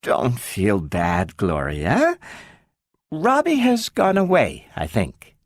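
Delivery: slow, lightly voiced tender mom; mother telling daughter Robbie left; gentle mother. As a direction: soften the blow. Mother breaks news gently.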